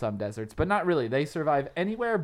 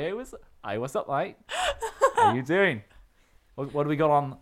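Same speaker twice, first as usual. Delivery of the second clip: playful put-on Australian accent; a party emu greeting the crowd with a jokey Australian accent; mock Australian accent; 6 dB louder than usual. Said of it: "stop doing that accent".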